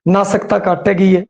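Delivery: usual speaking voice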